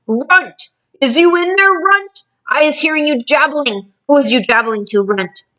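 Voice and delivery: thunderous shout. Acted. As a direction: yell for the runt like a giant. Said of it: "a voice like thunder shouted".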